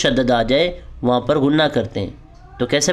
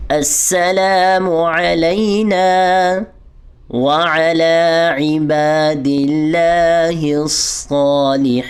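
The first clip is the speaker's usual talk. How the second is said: recite along; much slower than usual. Shown as a demonstration. Teacher leading students through the whole tashahhud. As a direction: chant slowly for students to follow. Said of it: "میرے ساتھ پڑھ لیجئے".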